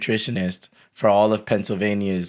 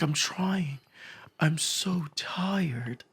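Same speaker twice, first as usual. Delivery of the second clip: high, breathy whisper, very slow; soft high mock of a wiped-out roommate; imitating the roommate; 6 semitones higher, 9 dB quieter, much slower than usual. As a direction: play the exhausted roommate barely audible. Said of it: "But this is exactly how he talks".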